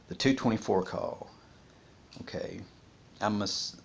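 ordinary delivery

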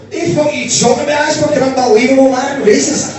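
high, loud mock rage; ironically billed as very calm but roaring; furious outburst. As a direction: explode in fake outrage at the accent jibe. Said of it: "very, very calm".

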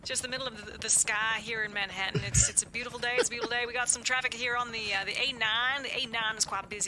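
a chipper helicopter traffic reporter voice; Chopper Dave. Pretend. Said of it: "this is Chopper Dave".

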